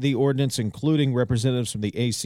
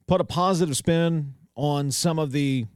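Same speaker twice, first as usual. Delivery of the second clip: normal talking voice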